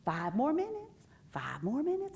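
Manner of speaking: high, soft perky teacher voice; soft chirpy teacher to crying babies. Retold or imitated. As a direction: coax the little ones sweetly. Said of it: "with my perky voice".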